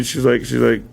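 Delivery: usual speaking voice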